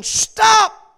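high, loud preacher's cry of stop; a single shouted command, high and loud; screamed stop. Retold or imitated. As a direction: shout it after the fleeing army. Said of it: "one man behind them screaming".

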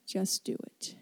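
unvoiced, intimate whisper. Shown as a demonstration. Preacher urging the standing congregation, whispered. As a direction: breathe the words like a secret.

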